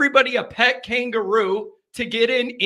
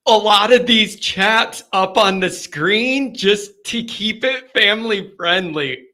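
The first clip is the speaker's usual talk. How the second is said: ordinary talk, nothing marked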